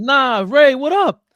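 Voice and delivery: high, loud imagined challenge; acted loud greeting. Imagining how the friend would greet Ray back then, loud acted greeting.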